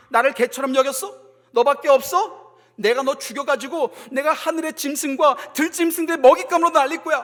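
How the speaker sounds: a touch of scornful menace; mocking threat. Goliath threatening young David, mildly hammed-up taunt. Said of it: "막 조롱하는 거죠".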